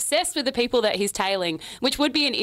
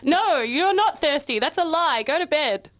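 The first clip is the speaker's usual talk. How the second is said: higher, drawn-out motherly scolding; hammed-up exasperated mum; mock stern mother; 4 semitones higher than usual. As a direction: send the children to bed, play-acting. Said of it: "I'll be the mother".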